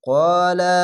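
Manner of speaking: ক্বালা with the আ held one alif length; drawn alif. Shown as a demonstration. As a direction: stretch the আ in ক্বালা. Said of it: "এক আলিফ টেনে পড়বেন".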